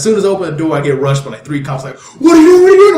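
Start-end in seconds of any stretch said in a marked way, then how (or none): 2.2-3.0 high, loud, urgent yelling; alarmed shout. Retold or imitated. Cops rushing him at the store door, shouting. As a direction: bellow it like police rushing an intruder.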